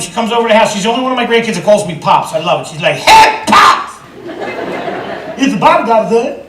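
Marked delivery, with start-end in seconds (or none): 3.0-6.4 high-pitched imitation of a toddler; toddler babble. Granddaughter comes over and greets Pops, imitated toddler babble. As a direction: chirp it like a five-year-old with garbled words. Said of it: "She speaks her own frickin' language".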